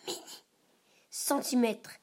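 ordinary delivery

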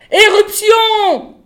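high, drawn-out full-voiced shout; long, loud, high-pitched alarm cry; screamed alarm. A narrator's reading. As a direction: scream a warning out in every direction. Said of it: "hurla-t-elle ensuite".

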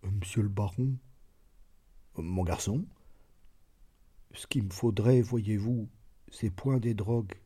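soft, hesitant servant speaking up; shy servant confessing to his master, timid and soft. A narrator's reading. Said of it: "d'une voix timide".

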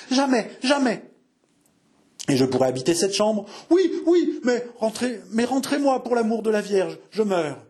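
high, pleading cries for mercy; panicked begging. A narrator's reading. Innkeeper hanging out the window begs to be pulled in, high. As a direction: beg for your life.

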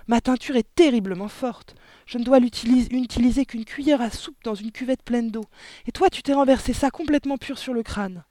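high, loud scolding wife; exasperated berating. Acted. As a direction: lecture your foolish husband. Mother berates her foolish husband. (remontrances aiguës et exaspérées)

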